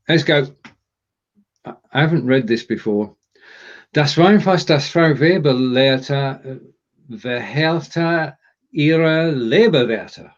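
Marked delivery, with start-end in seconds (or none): 3.9-10.3 slow, deliberate German reading; halting first attempt, drawn out; careful tongue-twister. Real, not acted. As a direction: pick through the tongue twister word by word. Sight-reads German tongue twister.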